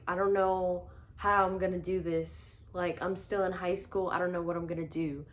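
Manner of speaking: normal talking voice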